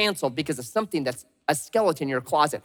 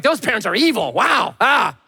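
louder, rising mock exasperation; a parent fed up at a kids' game; mock outrage; 6 semitones higher, 4 dB louder than usual.

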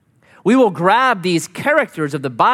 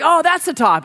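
higher, bright, eager "Oh"; excited exclaim; 12 semitones higher than usual. Retold or imitated. Worn-out climber thinking he reached the summit, excited. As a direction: burst out, sure you have arrived. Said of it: "So you're like".

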